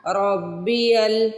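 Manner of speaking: रब्बियल with a doubled bb; रब्बियल, bb held firmly; joined ra and ba. Shown as a demonstration. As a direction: join रा to बा in रब्बियल. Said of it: "पहले रा को बा से मिलाना है".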